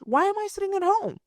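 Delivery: quick, high disbelief; incredulous outburst. Real, not acted. Asking viewers in quick, high disbelief. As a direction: fire it off in disbelief. Outraged rhetorical question.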